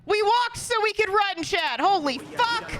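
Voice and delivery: real triumph, stretched out; excited shouting. Real, not acted. Celebrating a perfect segment.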